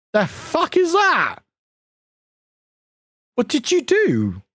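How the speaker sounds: high, slow incredulous demand; real disbelief. Real, not acted. Streamer sees an unknown chat feature, incredulous. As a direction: draw it out in high-pitched bafflement.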